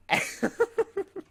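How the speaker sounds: high burst of giggles; laugh. Real, not acted. Vlogger recalling begging for subscribers with jerky, laughing. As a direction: crack up at your own story. Laughs at his own story.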